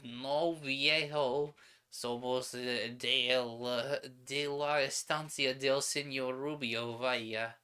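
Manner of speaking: put-on tipsy sheepherder in a Spanish accent; hint of a Spanish-accented drunk. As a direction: slur it like a drunk Spanish ranch hand. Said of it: "That was very hard to do in that accent".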